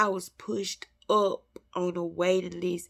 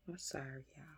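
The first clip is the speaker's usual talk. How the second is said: tearful apology; 4 semitones lower, 17 dB quieter, much faster than usual. Real, not acted. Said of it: "I'm a crybaby".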